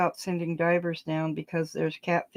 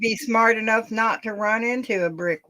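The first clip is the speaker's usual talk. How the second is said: shouted punchline, louder and higher; loud comeback; 5 semitones higher, 10 dB louder than usual. Real, not acted. Friend cuts in with a loud mock answer. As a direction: blurt it out over him. Shouting over the other guy.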